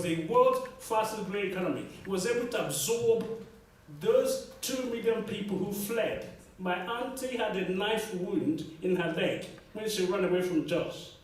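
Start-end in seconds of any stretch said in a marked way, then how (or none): none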